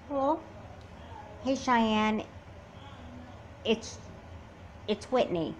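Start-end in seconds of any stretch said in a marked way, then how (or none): none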